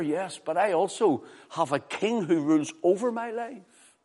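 high, loud, impassioned challenge; full-force preaching. Real, not acted. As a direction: proclaim and question from the pulpit.

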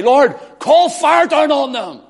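high, loud demand for fire; hammed-up angry disciple begging for fire; sons of thunder. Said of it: "who look at the Samaritans ... and say".

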